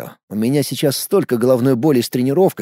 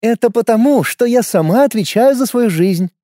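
instructive tone; 7 semitones higher, 3 dB louder than usual. A narrator's reading. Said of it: "наставительным тоном произнесла она".

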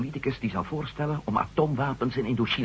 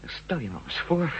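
higher-pitched, urgent warning; agitated warning; 7 semitones higher, 4 dB louder than usual. Acted. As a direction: press the danger home, pitch climbing. Heated warning about the bomb. (opgewonden waarschuwing)

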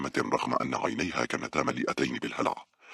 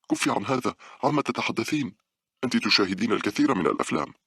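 high-pitched, agitated protest; agitated rebuke; 9 semitones higher than usual. A narrator's reading. Monica stops her friend's morbid talk, agitated rebuke. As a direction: tell a friend off in a raised pitch.

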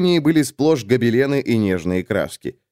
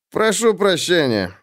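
offhand; 7 semitones higher than usual. A narrator's reading. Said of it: "небрежно бросил он".

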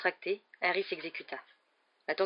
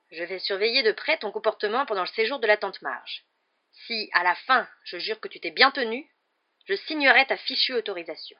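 raised voice, grudging and forceful; stern, curt; 4 semitones higher, 6 dB louder than usual. A narrator's reading. Uncle setting his nephew conditions for a signature, curt and stern. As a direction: lay down the terms sternly. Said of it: "dit-il enfin d'un ton sec".

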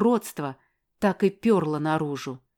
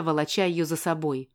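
usual speaking voice

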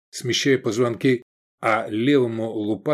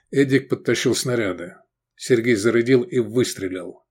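ordinary delivery, nothing marked

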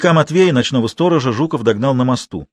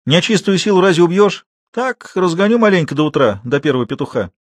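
higher, louder dismissive explanation; scornful; 7 semitones higher, 3 dB louder than usual. A narrator's reading. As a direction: explain with disdain, easing off at the end. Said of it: "презрительно выговорил Матвей".

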